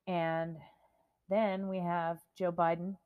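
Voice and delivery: ordinary delivery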